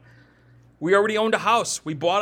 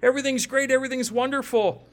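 crowd urging Jesus to stay; 7 semitones higher than usual. Retold or imitated. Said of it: "They're like".

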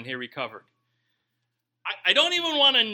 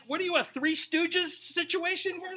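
incredulous; 11 semitones higher than usual. Retold or imitated. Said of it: "you're like".

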